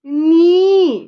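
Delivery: "नी" loud and high with a long ee; model for repeating. Shown as a demonstration. Mother has her child repeat knee, long loud ee. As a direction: stretch "नी" high for the child to echo. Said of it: "बोलो".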